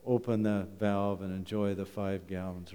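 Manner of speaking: ordinary talk, nothing marked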